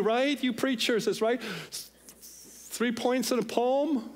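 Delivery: put-on high whiny complaint, echoing the bored child; impatient churchgoer. Bored congregant to pastor about the sermon, whining. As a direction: put on a high, fed-up listener's voice. Voicing an impatient listener like the son.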